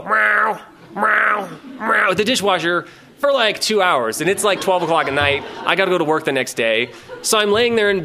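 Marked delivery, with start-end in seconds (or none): 0.0-2.1 insistent higher-pitched meows; drawn cat meow, repeated. Retold or imitated. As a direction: imitate a cat yowling over and over. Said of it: "proceeds to go".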